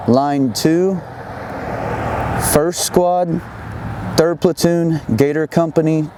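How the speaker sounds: slow, clipped radio call-out; radio voice procedure. Shown as a demonstration. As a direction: read the report like a radio operator. Sends spot report over radio.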